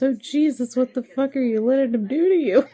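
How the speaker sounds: high, loud, shocked yell; high, loud mock outrage; shocked Johnny. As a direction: blurt it out, scandalised. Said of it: "And Johnny goes".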